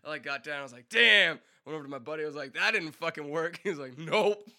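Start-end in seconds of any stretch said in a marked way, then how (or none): 0.9-1.4 sudden high shouted curse; loud curse. Retold or imitated. After crashing off the stage, a loud high curse. 2.5-3.6 raised, higher complaint; rueful exclamation. Retold or imitated. Telling his buddy the stage dive failed, loud and high. 4.0-4.5 a loud, high "nope"; one sharp word, no sympathy; curt reply. Retold or imitated. The buddy answering him, loud and high.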